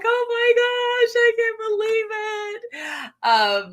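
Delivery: high, slow, tearful gasp of disbelief; retold disbelief. Vlogger retelling seeing the wedding stream, squealing tearfully. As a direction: relive the shock with a teary squeal. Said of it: "I was like".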